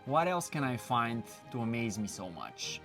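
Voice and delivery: usual speaking voice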